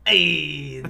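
loud laughing put-on Italian accent; mock Italian accent. Podcast hosts joking in a loud mock Italian accent. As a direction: bark it like a mock Italian tough guy. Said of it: "You can always do an Italian accent".

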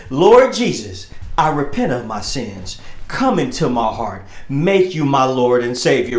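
low, soft mock sinner's prayer; mock altar-call prayer. Preacher mocking the church's repentance prayer, low and soft. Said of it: "He say".

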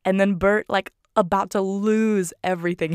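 normal talking voice

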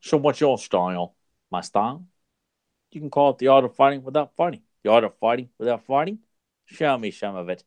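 two-person movie exchange in an imitated Hong Kong accent; measured martial-arts master accent, trading question and answer; Bruce Lee impression. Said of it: "you did a pretty good Bruce Lee".

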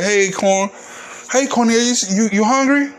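high, coaxing host voice; pushy host offering food. Retold or imitated. Host at a party pushing food on the fat guest, coaxing.

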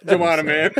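high, tickled, laughing repeat. Real, not acted. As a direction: say it while cracking up. Repeats the joke, cracking up.

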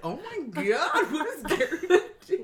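amused retold shock; mock horror. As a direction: draw out the exclamation, giggling. Retold horror while laughing.

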